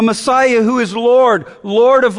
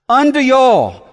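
"y'all" pushed out higher, then falling; emphatic y'all; 4 semitones higher than usual. Shown as a demonstration. Preacher addressing all peoples of the world, emphatic "y'all". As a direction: proclaim "y'all" to the whole crowd. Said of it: "The you or the y'all is emphatic".

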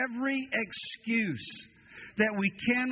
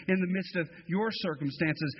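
ordinary talk, nothing marked

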